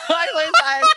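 high, loud burst of laughter; laughing too hard to finish. Real, not acted. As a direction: crack up mid-sentence. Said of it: "I knew that was gonna happen".

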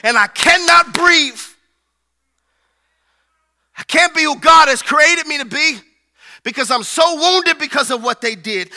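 loud, rising preacher's cry; full-force preaching. Acted. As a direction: preach it out to the whole church.

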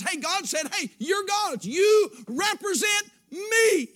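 rising, shouted sermon delivery; preaching at full force. Acted. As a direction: preach it, building to a roar on God. Preaching to the congregation.

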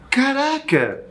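high-pitched mock disbelief; retold astonishment. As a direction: exclaim it, comically stunned. Retells own shock at supposed fight.

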